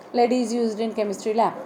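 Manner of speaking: ordinary talk, nothing marked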